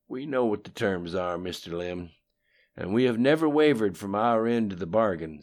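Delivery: lightly voiced cocky politician; Bill's voice. A narrator's reading. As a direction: brag about old schemes, loud. Bill answers Lim.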